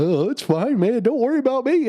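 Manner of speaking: high, breezy carefree voice; mock the clueless student, airy and unbothered; naive college kid. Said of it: "somebody who was a college kid".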